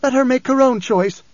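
high, urgent cry; screamed demand. A narrator's reading. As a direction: cry out in fierce insistence. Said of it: "Ganima screamed".

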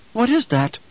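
high-pitched, anxious question; alarmed question. Acted. Mother staring at her son's hand, alarmed. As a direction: demand to know, pitched high.

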